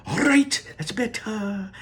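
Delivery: high, loud, urgent Wonka voice; frantic showman shooing kids from camera; Mister Wonka. Acted. Wonka warns Mike Teavee back from the camera, high and loud.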